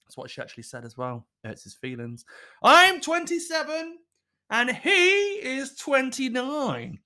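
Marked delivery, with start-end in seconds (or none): 2.6-7.0 put-on high, girly letter-writer voice; young woman voice. Reading letter, squeaky young voice.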